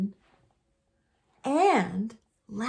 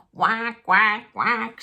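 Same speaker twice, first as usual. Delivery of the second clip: louder duck whack; duck cry; 5 dB louder, slower than usual. Acted. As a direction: call it like a worried duck. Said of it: "she cried".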